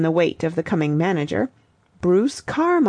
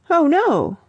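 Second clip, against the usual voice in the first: high cry of dismay, falling away; 9 semitones higher, 4 dB louder than usual. A narrator's reading. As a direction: cry out in dismay, pitched well up. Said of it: "cried Sally, dismayed".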